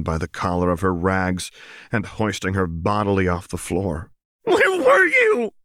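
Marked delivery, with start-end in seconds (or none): 4.4-5.5 very high, loud and slow scream; furious scream. A narrator's reading. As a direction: scream it in her face. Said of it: "inchoate noise of rage".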